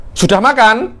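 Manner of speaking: a little angry. Shown as a demonstration. Teacher showing students the same words as an irritated reply, mildly angry. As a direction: answer "Sudah makan." with mild annoyance, pitch jumping up. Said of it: "ini sedikit marah ini".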